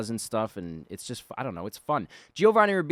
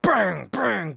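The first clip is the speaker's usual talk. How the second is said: high, loud banging noises; imitated crowbar smashes; 10 semitones higher, 9 dB louder than usual. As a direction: punch out each crashing hit.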